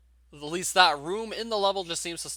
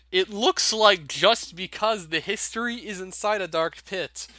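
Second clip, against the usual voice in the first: higher-pitched, barely contained amusement; amused reading; 6 semitones higher, 4 dB louder than usual. Real, not acted. Gamer re-reading the hack's odd note aloud, amused. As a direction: read it out on the edge of laughter. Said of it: "I'm sorry for laughing so much".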